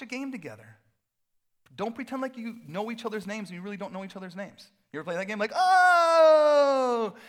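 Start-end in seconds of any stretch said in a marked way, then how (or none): 5.5-7.1 loud, high, drawn-out whoop; long excited "ohhh". Acted. Fan reacting to a game, big excited "ohhh". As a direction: belt out a long game-night holler.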